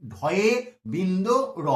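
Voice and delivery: ঢয়ে বিন্দু র drawn out slowly; name ঢয়ে বিন্দু র slowly for the class; slow careful naming. Shown as a demonstration. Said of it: "তাই এর নামটা হয়ে যাবে". (ধীরে ধীরে "ঢয়ে বিন্দু র" উচ্চারণ)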